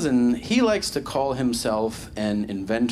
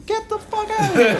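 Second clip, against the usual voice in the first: much higher and louder, laughing; laughing mock disbelief; 5 dB louder than usual. Comic mocking the imagined engineer's amazement, much higher and laughing.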